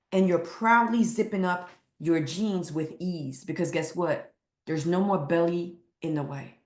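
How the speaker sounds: ordinary talk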